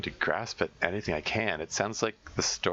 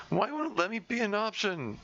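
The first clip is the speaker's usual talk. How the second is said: high, despairing plea through tears; tearful wail of frustration; anguished cry; 12 semitones higher than usual. Acted. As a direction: cry it out to no one, voice pitched up. Monica crying in despair.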